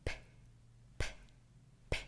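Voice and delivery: repeated p, each a quick burst from closed lips; popped p sounds. Shown as a demonstration. Teacher demonstrating lip pops to children, quick bursts. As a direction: pop it, pop it, pop it. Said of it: "and then breathe out quickly".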